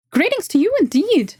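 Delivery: squeaky creature snapping orders. Acted. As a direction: squeak it out, busy and curt. Voices the elf woman.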